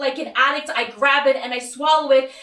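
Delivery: high, loud, relentless outpouring; frantic spiral. Acted. Acted spiral into addiction and panic.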